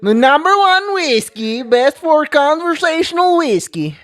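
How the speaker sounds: high, slow mock Russian accent. Shown as a demonstration. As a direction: read it like a Borat-style Russian. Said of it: "I need to read this in a Russian accent".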